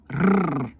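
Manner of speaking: R as a long rolled trill; extended rolling R; Italian rolled r. Shown as a demonstration. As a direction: trill the R on the tongue-tip like Italian. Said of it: "Comme en italien".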